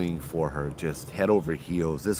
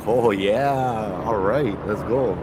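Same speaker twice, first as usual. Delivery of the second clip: louder, drawn-out eager "oh yeah"; eager agreement; 3 semitones higher, 5 dB louder, slower than usual. Acted. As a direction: play the smitten guy jumping at it. Acting man eager after a wink.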